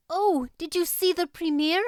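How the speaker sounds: put-on high, bright-eyed girlish question; eager exclaim. A narrator's reading. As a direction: burst out with eager curiosity. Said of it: "exclaimed Anne at once".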